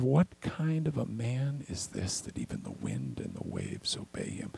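hushed wonder at the calmed sea; awed hush. Retold or imitated. Disciples awed after calming storm.

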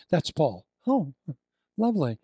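ordinary delivery

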